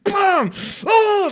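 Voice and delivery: high, loud mock yell of frustration; mock angry outburst. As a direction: shout it like slapping your legs at a game. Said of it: "She's like".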